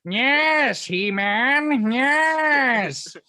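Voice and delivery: high, loud cackling villain; hammed-up cartoon villain; Skeletor voice. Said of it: "you turned into Skeletor".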